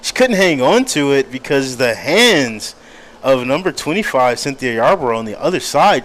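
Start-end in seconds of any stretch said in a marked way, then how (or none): none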